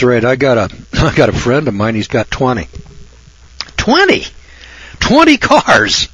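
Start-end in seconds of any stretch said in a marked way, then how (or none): 3.8-6.1 much higher, stretched out in disbelief; can't believe the number, let it fly up; incredulous exclamation. Real, not acted. Disbelief at friend's twenty cars.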